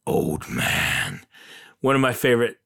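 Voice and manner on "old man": put-on gruff old man voice. To his wife, imitating an old man in a low growl. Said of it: "I'll say it like that".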